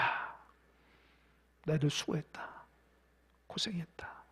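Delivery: soft, low, hushed comfort; tender whisper. Acted. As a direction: comfort tired disciples in a near whisper. Jesus voiced comforting disciples. (낮고 부드럽게 속삭이듯 위로)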